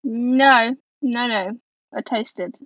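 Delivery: ordinary talk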